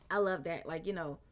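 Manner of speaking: ordinary delivery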